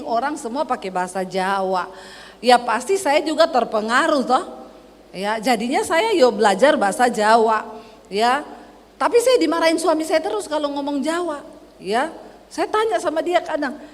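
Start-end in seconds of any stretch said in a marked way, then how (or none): none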